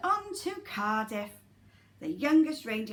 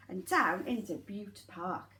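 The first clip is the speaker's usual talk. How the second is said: ordinary delivery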